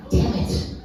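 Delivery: usual speaking voice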